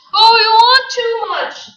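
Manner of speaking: high, loud desperate cry; cried out. A narrator's reading. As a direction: throw the words at Gatsby in a high outburst. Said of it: "she cried to Gatsby".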